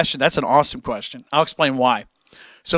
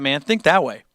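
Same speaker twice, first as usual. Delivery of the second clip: ordinary talk, nothing marked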